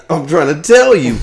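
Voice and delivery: loud, high-pitched exasperation; high, loud insistence; exasperated outburst. Real, not acted. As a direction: burst out, pleading to be heard. Exasperated agreement over missing controllers.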